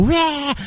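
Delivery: high, drawn-out "rah"; mock roar. Comic showing how he'd go out there, mock roar. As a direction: imitate a hyped-up stage roar. Mimes roaring at the crowd.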